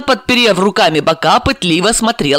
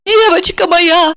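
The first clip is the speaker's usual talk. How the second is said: crying out; 12 semitones higher, 3 dB louder, slower than usual. Acted. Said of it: "закричала она".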